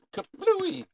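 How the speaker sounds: high-pitched cartoon explosion word; playful exclamation. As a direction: blurt it out high, like a comic blast.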